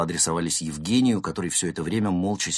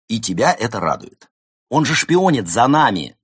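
loud, rising indignant cry; indignant outburst; 5 semitones higher, 9 dB louder than usual. A narrator's reading. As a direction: scold her, outraged. Cat scolds the fox. (громкое возмущение)

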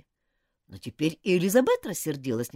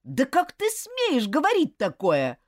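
slow, loud, offended outrage; angry retort; 11 semitones higher, 7 dB louder, slower than usual. Acted. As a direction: throw it back at her, indignant. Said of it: "рассердился Джулиан".

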